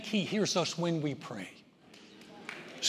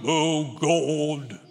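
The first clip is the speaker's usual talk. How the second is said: low, drawn-out, put-on praying voice; fake prayer voice; 3 semitones lower than usual. Shown as a demonstration. Preacher mocking how people pray to God, fake prayer voice. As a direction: intone it with mock solemnity, stretching each word. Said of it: "some fake voice".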